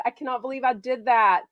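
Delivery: ordinary talk, nothing marked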